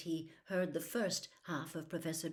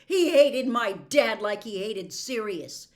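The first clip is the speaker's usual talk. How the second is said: loud, higher, scornful shout; bitter yell; 5 semitones higher, 10 dB louder than usual. Acted. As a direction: throw it at him bitterly. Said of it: "a yell of mirthless laughter".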